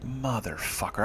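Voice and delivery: soft, slow muttered swear; low resigned curse to himself. Retold or imitated. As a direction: mutter it under your breath, defeated. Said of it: "and I'm like".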